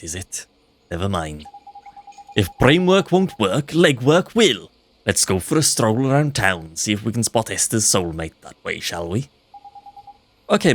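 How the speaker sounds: bouncy Welsh sidekick lilt. Pretend. Drippy planning the search with Ollie in Welsh. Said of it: "slip into Welsh".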